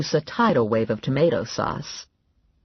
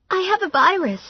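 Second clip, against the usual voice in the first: much higher, timid voice; meek Valerie; 3 dB louder, faster than usual. A narrator's reading. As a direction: answer shyly, embarrassed. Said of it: "Valerie said".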